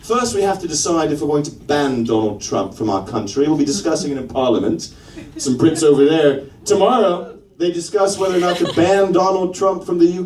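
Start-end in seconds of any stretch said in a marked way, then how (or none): 0.0-4.9 put-on measured British accent; deliberate formally in a British accent. Comedian as Brits debating a Trump ban, British accent. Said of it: "I think the Brits would be a good one".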